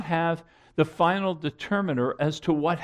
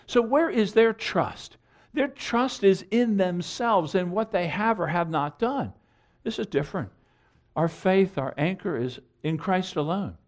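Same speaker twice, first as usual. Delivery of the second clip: usual speaking voice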